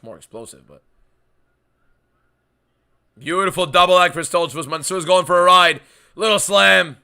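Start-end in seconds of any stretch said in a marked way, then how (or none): 3.2-7.0 loud, hyped fight call; excited play-by-play. Real, not acted. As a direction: call the slam with rising hype.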